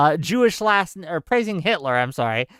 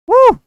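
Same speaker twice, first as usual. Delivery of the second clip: loud, high sarcastic whoop; a burst of ironic cheering; mock whoop; 11 dB louder than usual. Real, not acted. Streamer to chat after absurd posts, sarcastic whoop.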